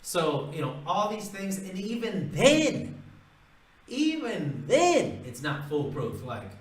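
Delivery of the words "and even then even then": much higher, slow, stretched even then; high, slow dramatic stress on even then; dramatic drawn-out emphasis. Real, not acted. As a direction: hang on even then for suspense.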